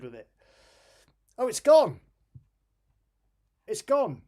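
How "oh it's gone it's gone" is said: a sudden louder, higher cry of surprise; real surprise, the folder finally vanishes; surprised. Real, not acted. Sudden success, deleted folder.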